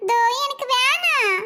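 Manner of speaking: put-on slow, drawn-out childish refusal; whiny child. Child turning down the offered ice cream, whining. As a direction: drag out the no like a sulky kid.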